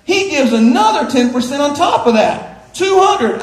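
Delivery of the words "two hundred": laughing amazement. Real, not acted. Amazed at the generous son.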